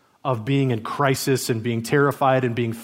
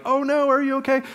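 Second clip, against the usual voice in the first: high-pitched mock alarm; panicked parent; 13 semitones higher than usual. Anxious parent checking a fallen child, high mock panic. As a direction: fret over the child in a high voice. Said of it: "the parents who are like".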